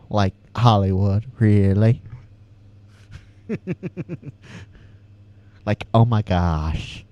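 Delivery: a hint of Valley Girl, rising on really; lightly voiced Valley Girl asking really. Shown as a demonstration. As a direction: toss it off like a mock Valley Girl. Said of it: "so Valley Girl".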